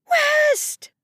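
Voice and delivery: very high, loud screech; frantic scream. A narrator's reading. Mother calling for her lost son, screaming. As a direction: scream out the child's name. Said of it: "she heard Justine's voice clearer".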